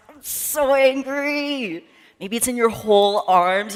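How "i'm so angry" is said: slow, higher, drawn-out mock anger; shaken-out anger. Shown as a demonstration. Leader shakes her hands for the kids, slow and high. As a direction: shake the words loose slowly. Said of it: "You need to shake it out".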